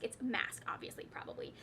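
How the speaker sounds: a breathy whispered correction under her breath; whispered aside. Real, not acted. Correcting an author's name to herself, whispered. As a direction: mutter the fix to yourself in a whisper.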